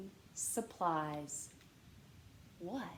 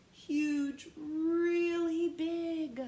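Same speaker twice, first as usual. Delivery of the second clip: higher, louder, slow stretched huge, really big; emphatic; 6 semitones higher, 6 dB louder, much slower than usual. Shown as a demonstration. Teacher acting out how big vast is. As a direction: stretch it out to show bigness. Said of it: "Vast is another great word".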